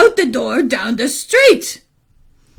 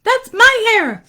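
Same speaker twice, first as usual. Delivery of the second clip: put-on high kid voice; insistent boy Aaron; 10 semitones higher than usual. Boy claiming hair from police, high and insistent. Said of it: "went to the police and said".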